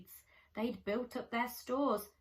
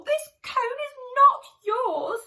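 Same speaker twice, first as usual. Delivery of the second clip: high, loud squirrel yell; angry shout; 14 semitones higher, 14 dB louder than usual. Acted. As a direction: yell it, refusing to back down. Squirrel quarrel over cone.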